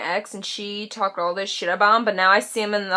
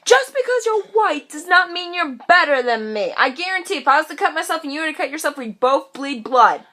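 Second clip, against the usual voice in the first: higher, louder indignant tirade; indignant rant; 5 semitones higher, 4 dB louder than usual. Real, not acted.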